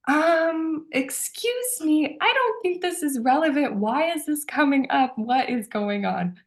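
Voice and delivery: high-pitched mock indignation, slowing on the last question; a mock offended "excuse me?"; baffled protest. Retelling her own baffled reaction.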